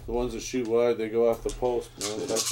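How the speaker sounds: usual speaking voice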